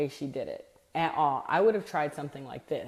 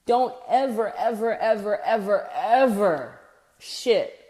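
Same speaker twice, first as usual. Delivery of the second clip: each ever hammered louder, slowly; emphatic warning; 3 semitones higher, 5 dB louder, slower than usual. Real, not acted. Sales coach hammering a warning to the audience, emphatic. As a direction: drive the warning home word by word. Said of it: "emphatic sales warning".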